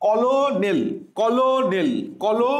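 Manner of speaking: "কলোনেল" repeated as kolo-nel in measured beats; spelling pronunciation. Shown as a demonstration. Teacher repeats for memorising, "কলোনেল" as written. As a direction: drill "কলোনেল" with the L sounded. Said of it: "স্পেলিংটা হবে কি?".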